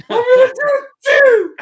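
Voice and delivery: very high, loud squealed outburst; excited squeal. Retold or imitated. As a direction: squeal with laughing eagerness. Retold excitement behind tree.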